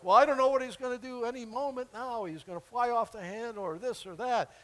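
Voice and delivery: mock anxious voice. Imagined unreliable leader voiced.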